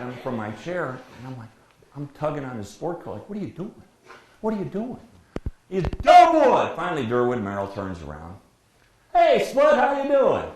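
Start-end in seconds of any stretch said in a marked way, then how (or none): 3.3-5.0 high-pitched, contained plea; mild alarmed protest. Retold or imitated. Partner tugging his colleague's coat, high alarmed protest. 5.8-6.7 high, booming holler of the name. Retold or imitated. Broadcaster ignoring his partner, yelling at the umpire. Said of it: "he starts yelling". 9.1-10.4 high, loud holler back up to the booth. Retold or imitated. Umpire turning round to greet the broadcaster, calling out.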